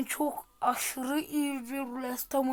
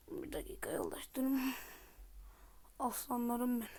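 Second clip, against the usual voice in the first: whisper; 9 dB quieter than usual. Real, not acted. Breath pause whisper.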